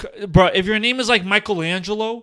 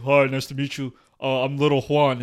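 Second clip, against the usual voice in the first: deep voice; 6 semitones lower than usual. Retold or imitated. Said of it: "everybody sounds so high pitched".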